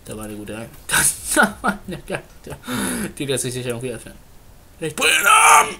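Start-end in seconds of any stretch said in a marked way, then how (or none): 4.8-5.8 high, loud playful rage; mock furious shout. Real, not acted. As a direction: yell the threat in hammed-up fury. Enemy escapes again.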